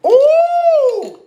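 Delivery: a sharp, loud, high-pitched ooh of outrage; high outraged squeal. Real, not acted. Upset by fake gravy, a loud high squeal. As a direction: squeal it out like the thought hurts.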